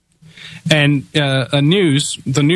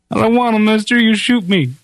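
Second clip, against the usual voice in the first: much higher, pleading little voice; frightened sheep herder; 8 semitones higher than usual. Retold or imitated. Sheep herder to the gunslinger, a high frightened plea. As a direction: beg the gunman not to make you fight. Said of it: "the sheep herder's feet".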